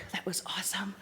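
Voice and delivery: a quick unvoiced whisper to herself; murmured to herself, barely voiced; whispered aside. Real, not acted. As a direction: breathe it out under your breath. Aside to herself mid-talk.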